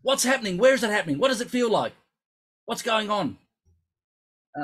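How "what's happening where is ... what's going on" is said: high, loud frantic questions; retold screaming. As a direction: fire off the questions like a panicked coach. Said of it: "hours of me screaming".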